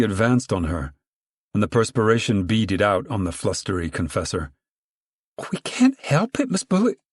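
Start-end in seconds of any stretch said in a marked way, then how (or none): 5.5-7.0 very high anxious plea; pleading whine. Acted. Flustered woman pleads.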